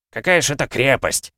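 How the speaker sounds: high, dismissive question; indignant dwarf retort; objecting dwarf. A narrator's reading. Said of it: "возразил гном".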